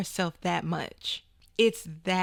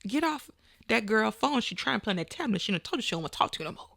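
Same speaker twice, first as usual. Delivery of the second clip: ordinary talk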